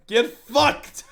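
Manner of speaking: laughing through the words. Real, not acted. Joking he spoiled his friend's dad, laughing taunt. Gloating over dad's spoiled episode.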